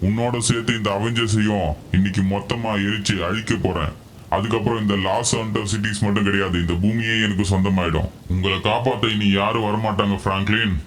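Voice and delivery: low, soft menacing villain voice; villain threat. Acted. Villain gloats to Franklin, low and menacing.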